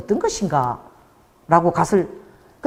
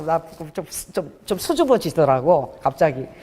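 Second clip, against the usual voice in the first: usual speaking voice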